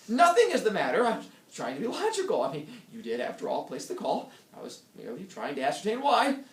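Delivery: husband defensively arguing with his wife. Acted. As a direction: force logic on her.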